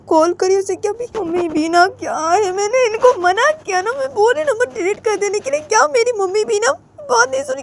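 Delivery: high, crying complaint; tearful frustration. Acted. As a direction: whine near tears, high. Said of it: "बोलती है".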